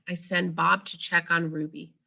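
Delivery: ordinary delivery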